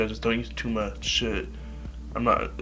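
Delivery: ordinary talk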